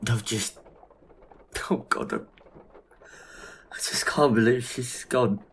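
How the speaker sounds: slow, broken voice close to tears; choked-up grief. Acted. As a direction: let the grief catch in the throat. Grieving dead friend Melanie.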